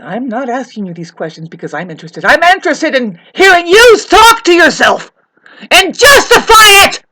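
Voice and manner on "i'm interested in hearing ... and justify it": high, loud outburst; angry yelling. Real, not acted. Demanding the neighbor explain themselves, angry high shout. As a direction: yell it as a fierce demand.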